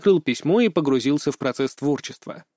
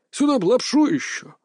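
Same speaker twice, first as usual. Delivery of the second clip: put-on sighing old man voice, higher; longing grandpa; wistful old man; 8 semitones higher than usual. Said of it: "тоскливо вздохнул дед".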